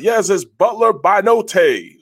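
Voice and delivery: stretched-out, booming sports announcer call. Retold or imitated. Friend mimics the stadium announcer naming a player, booming announcer voice. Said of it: "I hear the announcer talking about".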